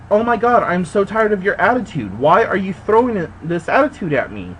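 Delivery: yelling at the clerk, sick of her attitude; exasperated outburst. Retold or imitated. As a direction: throw the frustration back at her. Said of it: "and I'm like".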